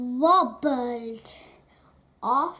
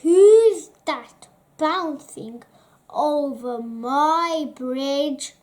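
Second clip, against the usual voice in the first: loud bellowing troll voice; roaring troll challenge; 7 dB louder than usual. Acted. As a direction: boom it out from below. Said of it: "bellowed the troll".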